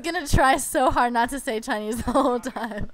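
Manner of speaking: slow, laughing through the words; real laughter at her own slip. Real, not acted. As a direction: giggle while confessing the slip.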